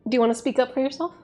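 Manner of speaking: lightly doting dog voice. Real, not acted. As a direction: coax the dog up to the mic. Talking to dog.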